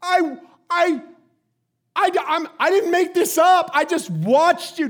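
loud, high, stammering protest; outraged sputtering. Retold or imitated. Dad insisting he watched daughter, outraged. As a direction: sputter and shout in disbelief.

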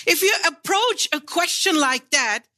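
ordinary delivery

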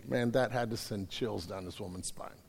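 ordinary talk, nothing marked

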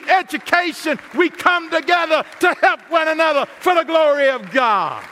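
loud, high, rising preacher's build; full-force preaching. Acted. Preacher recounts son's injury and God's answer, impassioned loud preaching. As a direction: shout the testimony like a revival peak.

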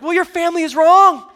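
hammed-up indignation at the other family; mock rant. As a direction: pastor mock-shouting about a family's game rules. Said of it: "I was like".

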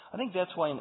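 ordinary talk, nothing marked

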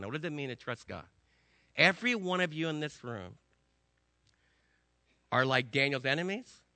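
usual speaking voice

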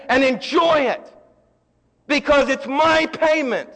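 loud, high-pitched pulpit preaching; fiery preacher proclaiming forgiveness; full-force preaching. Acted. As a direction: preach it out to the congregation with fire. Preaching, acting judge and Christ.

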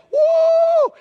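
high, loud "woo" shriek; comic scream of fright; imitated screaming. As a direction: let out one long mock scream. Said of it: "we start screaming".

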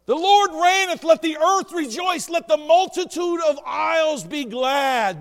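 loud, high, ringing proclamation; proclaiming scripture. Acted. Preacher reads Psalm ninety-seven to the church, proclaiming loudly. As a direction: declare the psalm with joy.

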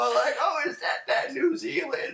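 very high, drawn-out imitation; high, laughing mock amazement; marvelling grandpa. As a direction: ask in wide-eyed wonder like a grandpa.

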